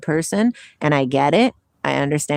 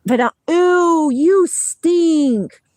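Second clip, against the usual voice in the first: loud, higher cry of disgust; real loud disgust at the dog; disgusted outcry; 10 semitones higher, 6 dB louder, much slower than usual. Real, not acted. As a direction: recoil loudly from the smell.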